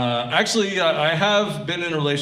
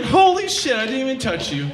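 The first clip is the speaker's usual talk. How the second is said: high, loud amazement; astonished; 8 semitones higher, 4 dB louder than usual. Acted. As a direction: burst out in high-pitched shock. Amazed reaction in acted scene.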